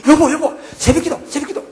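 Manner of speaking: high, loud urgent calling; a wife shouting her husband up for dawn prayer; wife calling him awake. Retold or imitated. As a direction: shake him awake with your voice.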